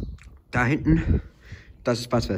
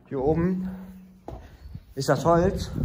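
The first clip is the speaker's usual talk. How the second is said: ordinary talk, nothing marked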